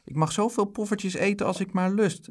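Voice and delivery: put-on high-pitched little boy arguing; high, eager boyish quote; insistent child. A narrator's reading. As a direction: plead your case in a high child's voice. Boy argues with mother, quoting Anneke.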